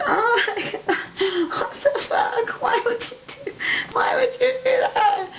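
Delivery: very high, slow, laughing mock shriek; hammed-up high shriek of a hurt mom; exaggerated mom freakout. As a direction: ham up mom's shocked whine. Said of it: "and she was like".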